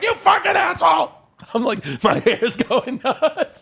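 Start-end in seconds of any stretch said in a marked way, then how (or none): 0.0-1.1 mock screaming. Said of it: "I started screaming at my door".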